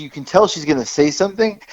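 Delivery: ordinary talk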